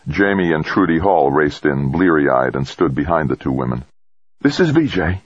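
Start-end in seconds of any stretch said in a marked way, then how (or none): 4.4-5.2 high-pitched character voice for the doctor; physician on comms. A narrator's reading. Base physician takes the astronaut's call, high-pitched professional voice. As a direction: answer the radio in a high voice.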